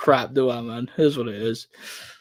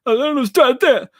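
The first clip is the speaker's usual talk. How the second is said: high, slurred boxing trainer impression; garbled high-pitched rambling, words mashed together; Roger Mayweather; 3 dB louder than usual. Streamer showing off his best Mayweather, high slurred impression. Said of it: "that's my Roger Mayweather".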